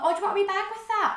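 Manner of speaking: high, quick customer-service patter; chirpy shop-worker imitation. Shop worker offering a customer a bag, high and quick. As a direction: pitch it up and rattle off the offer.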